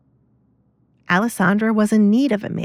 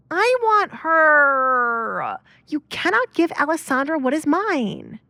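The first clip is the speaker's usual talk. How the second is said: put-on much higher, very slow start, spoiled girl demanding; possessive daughter; 8 semitones higher than usual. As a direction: insist it's yours, drawn out. Kate claims the maid from father.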